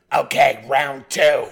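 big loud shout to restart a fight; mock fight announcer. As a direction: shout it out like the next round is starting. Acts out the fight resuming.

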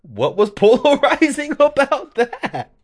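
high, loud, laughing disbelief; cracking up while asking what was wrong; laughing incredulous. Retold or imitated. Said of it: "I was like".

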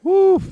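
high loud whoop; loud high woo. Real, not acted. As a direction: let out a whoop. Exclaims in disbelief.

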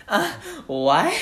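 high, loud drawn-out "uh, what?" through a laugh; mock-baffled squeak; obnoxious confused "what". Shown as a demonstration. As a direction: play dumb as obnoxiously as you can. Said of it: "It sounds exactly like how we say it".

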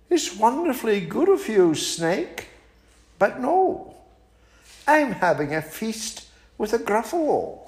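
high, polite little mouse turning down an invitation; small and prim, declining sweetly; a high, prim mouse fibbing to the owl. Acted.

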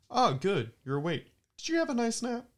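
high chirpy fake-sweet robot lady; bright taunting android host; android neighbor voice.

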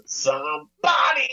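high, drawn-out belted shout; mock singer's opener. Mocking the friend's way of starting sentences, stretched high shout. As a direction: belt it out like a rock hook. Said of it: "he just always starts every, every sentence with".

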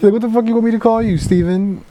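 very high, animated retort; retold mock exasperation. Host retells woman answering Chicklet, high mock exasperation. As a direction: fire back in a high, imitated voice.

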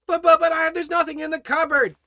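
high, loud, stammering worrier; mock fretting. Worried believer protesting about an empty cupboard, mock fretting. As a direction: panic in a hammed-up high voice. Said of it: "you're worried and fretting and fearing".

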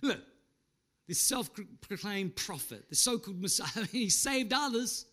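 jeering, sneering voice; mock him like the crowd at the cross. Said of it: "they started to snigger and mock".

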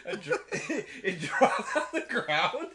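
loud, high, giggly telling; laughing while talking. Real, not acted. Host explains how the drink spilled, laughing hard. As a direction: barely get the account out through laughter.

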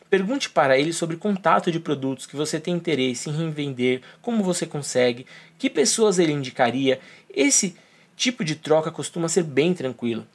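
normal talking voice

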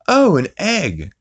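high, slow, put-on Stewie surprise; Stewie surprised. Stewie finds he laid an egg, high slow coo.

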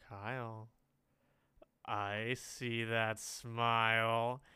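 put-on drawn-out, slow teasing of a sulking kid; teasing coaxing. Cousin kneels to a pouting kid, slow teasing coax. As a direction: coax it out slowly, stretching the words. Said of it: "he'd, like, kneel towards me".

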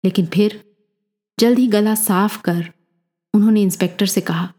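usual speaking voice

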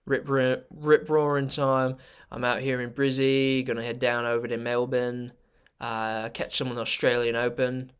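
put-on Aussie snowboarder accent, drawing out key words; Australian accent. Snowboarder chatting about a trip to Melbourne, Australian accent. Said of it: "I would like you to do your Australian".

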